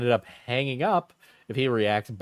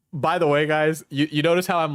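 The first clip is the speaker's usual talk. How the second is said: ordinary delivery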